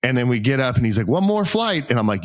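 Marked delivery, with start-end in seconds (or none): 1.1-1.9 high, lilting retold announcement; mock call-out. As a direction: imitate someone calling out, pitched up high. The climb leader calls out cheerily.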